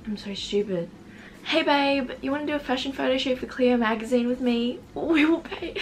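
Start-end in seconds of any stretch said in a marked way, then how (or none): 1.4-5.8 higher, lightly hammed-up texter; mock message voice. Vlogger retries the girl's pitch before cracking up, mock message voice. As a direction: read her message in a raised mock voice. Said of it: "This is what she said".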